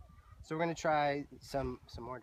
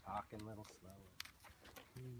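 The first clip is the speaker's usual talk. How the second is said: voice brought down; 3 semitones lower, 9 dB quieter than usual. Shown as a demonstration. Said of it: "start bringing my voice down".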